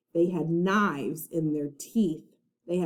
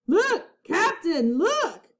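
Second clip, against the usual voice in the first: high, excited shout; excited call; 10 semitones higher, 3 dB louder, slower than usual. A narrator's reading. Pinky calling down to the captain, high excited shout. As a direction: call down in glee.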